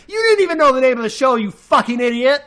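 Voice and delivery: real yell of rage, words rushing out; angry yell. Real, not acted. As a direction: blow up at him, fast and loud. Furious rant at critic.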